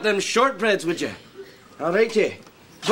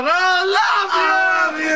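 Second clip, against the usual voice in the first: overwrought affection; 7 semitones higher, 4 dB louder than usual. Acted. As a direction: burst out with love mid-quarrel. Brothers make up hysterically.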